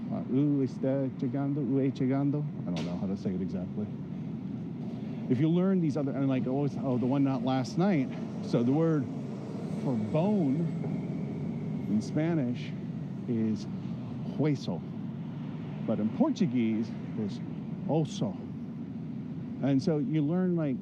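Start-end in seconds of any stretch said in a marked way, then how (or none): none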